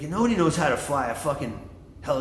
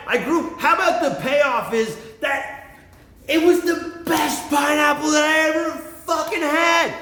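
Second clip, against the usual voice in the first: high, loud, near-shouting pride; fired-up boast; 10 semitones higher, 3 dB louder than usual. Real, not acted. Bragging about his own pineapple, loud and high. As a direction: belt it out with real passion.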